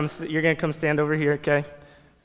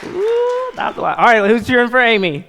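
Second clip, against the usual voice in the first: hype cheer; 6 semitones higher, 5 dB louder than usual. Real, not acted. Hyping the crowd for contestants.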